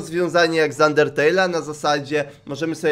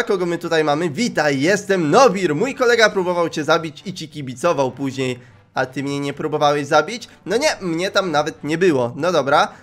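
ordinary talk

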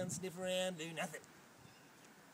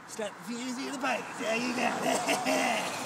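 loud, slow yell across the street; drawn-out shouted hello; calling out; 6 semitones higher, 10 dB louder, slower than usual. Acted. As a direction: bellow a matey greeting at strangers. Yelling at people passing by.

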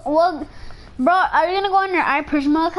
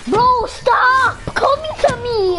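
high, drawn-out yell of protest; real exasperated shout; 7 semitones higher, 3 dB louder, slower than usual. Real, not acted. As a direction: shout them off, slow and high. Yelling at opponents targeting him.

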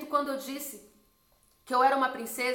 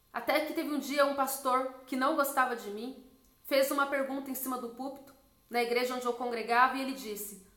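ordinary talk

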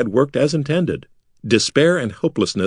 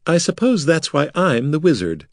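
dry; 7 semitones higher than usual. A narrator's reading. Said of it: "she replied dryly".

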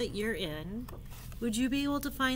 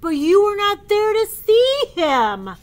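complaining elephant; 10 semitones higher, 13 dB louder than usual. Acted. Gerald voiced in book.

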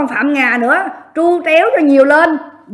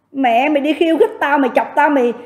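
ordinary talk

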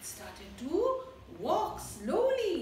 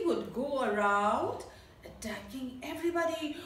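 put-on reading to children; 2 semitones lower, 3 dB quieter than usual. A narrator's reading. Teacher telling her class a story, gentle narration. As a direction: draw the children into the jungle.

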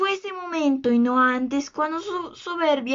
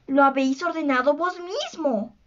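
ordinary delivery

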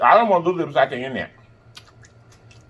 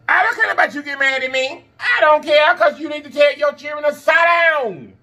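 raised voice, fired up; heated shout; 13 semitones higher than usual. Real, not acted. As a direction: shout it out in frustration. Ranting at careless parents.

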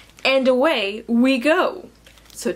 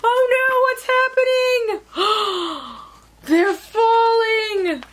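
high, panicky storybook cry; alarmed; 10 semitones higher than usual. Acted. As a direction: gasp and exclaim in mock alarm. Balloon starts falling.

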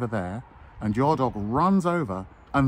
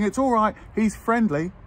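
much higher, louder mock "it's all right"; hammed-up owner shouting reassurance, higher; imitating cheery owner; 10 semitones higher, 3 dB louder than usual. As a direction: call out breezily across the park like the careless owner. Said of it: "the owner's going".

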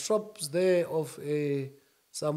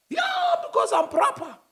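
much higher, shouted boast; high-pitched; 3 dB louder than usual. Real, not acted. Answering the friend's jab, high and shouty. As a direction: squeal it out proudly. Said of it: "your voice is going higher and higher".

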